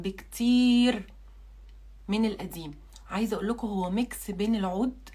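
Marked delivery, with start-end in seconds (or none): 0.0-1.1 drawn out. Acted. Stretched for emphasis.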